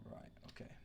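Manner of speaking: a quick whispered aside to himself; soft unvoiced mutter while busy. Real, not acted. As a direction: whisper it under your breath. Muttering to himself mid-game.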